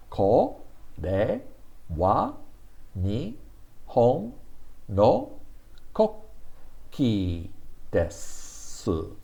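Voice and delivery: slow, each syllable apart; one second per syllable. Shown as a demonstration. Teacher pacing the sentence one syllable per second. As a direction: beat out each syllable like a metronome. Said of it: "this is one second per, uh, letter".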